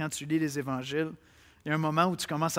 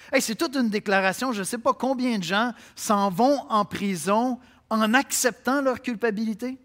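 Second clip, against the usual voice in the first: louder and higher, building preacher's cry; fervent preaching; 5 semitones higher, 7 dB louder than usual. Acted. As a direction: drive the refrain home with rising fervour. Preacher builds to full force. (prédication fervente, de plus en plus forte)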